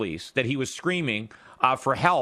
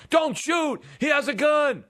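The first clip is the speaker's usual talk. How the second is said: pretend scream; 11 semitones higher than usual. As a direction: yell it out as if a gun is on you. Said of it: "the only thing you would scream would be".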